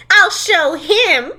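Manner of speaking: high, drawn-out defiant vow; mock defiance. Friend mocking the heroine's revenge plan, hammed-up defiance.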